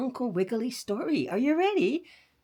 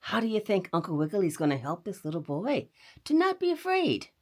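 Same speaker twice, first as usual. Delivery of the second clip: usual speaking voice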